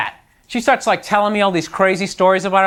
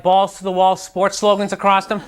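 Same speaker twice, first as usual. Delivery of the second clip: ordinary talk, nothing marked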